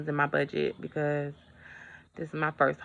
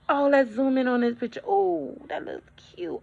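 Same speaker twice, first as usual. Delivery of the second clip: higher, cooing over photos, dropping to a hush; mock house hunter; 9 semitones higher than usual. Mimicking buyers zooming on photos, cooing high. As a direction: coo over the listing pictures. Mimicking couple browsing listing.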